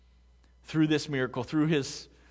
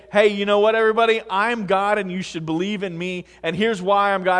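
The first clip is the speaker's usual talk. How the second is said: loud, higher-pitched pretend announcement; mock proclamation; 5 semitones higher, 7 dB louder than usual. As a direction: proclaim it to the whole room as a mock claim. Hypothetical self-proclamation to the congregation.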